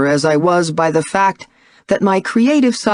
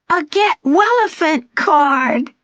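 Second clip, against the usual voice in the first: put-on little girl voice; 11 semitones higher, slower than usual. A narrator's reading. Young daughter voiced by narrator.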